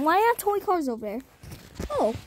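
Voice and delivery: ordinary talk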